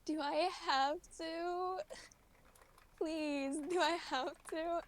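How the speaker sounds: reluctant pleading. Retold or imitated. Cadet begs the instructor to skip the wall, reluctant pleading. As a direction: act out a reluctant whine of protest. Reluctant plea retold in story.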